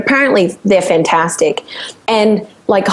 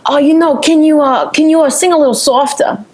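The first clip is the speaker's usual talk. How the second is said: higher-pitched Long Island studio guy; Long Island accent; 6 semitones higher than usual. Retold or imitated. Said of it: "the accents are just so different".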